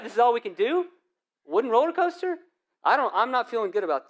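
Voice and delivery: put-on incredulous kid. Kid scared of roller coaster.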